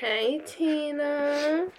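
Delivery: high, long drawn-out greeting; playful call-out. Real, not acted. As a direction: stretch the hello out high and teasing. Calling out playfully to Tina.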